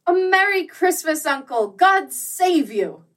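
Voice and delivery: high, loud joyful greeting; cheerful cry. A narrator's reading. Nephew bursting in to greet his uncle, loud and cheerful. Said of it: "cried a cheerful voice".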